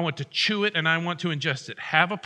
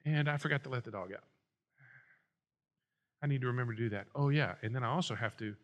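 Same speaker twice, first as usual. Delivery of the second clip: low, hushed aside mid-prayer; absent-minded note to self, much quieter and lower; distracted inner thought; 7 semitones lower, 9 dB quieter, faster than usual. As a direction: drop to a soft, low murmur as the mind wanders. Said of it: "does anybody have this problem when they sit down to pray".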